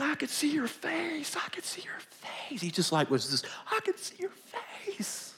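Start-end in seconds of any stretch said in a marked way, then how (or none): 0.0-2.6 high, hushed mock scream; retold scream, whispered. Interpreter relays the healed man's words, whispered mock scream. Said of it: "he was just screaming it and screaming". 3.7-5.3 slow, high-pitched whispered mock scream; retold scream, whispered. Preacher re-enacts healed blind man seeing his wife, whispered scream. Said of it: "he was just screaming it and screaming".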